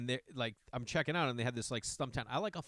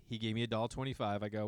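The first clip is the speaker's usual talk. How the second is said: ordinary talk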